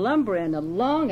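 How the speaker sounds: usual speaking voice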